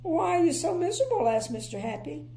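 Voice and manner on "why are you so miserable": curious cheerful question. Acted. Mister Happy asks.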